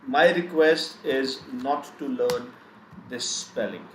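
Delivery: ordinary talk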